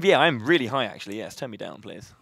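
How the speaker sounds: usual speaking voice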